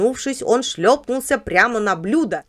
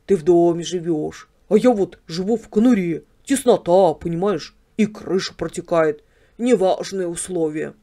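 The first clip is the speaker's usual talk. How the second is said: Bobik the yard dog. Acted. Yard dog tells house dog about his kennel, rueful. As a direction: play a humble kennel dog airing his lot.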